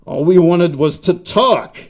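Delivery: lightly voiced Yank spider, thick Yank accent; mildly hammed-up thick Yank accent. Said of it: "in that thick Yank accent".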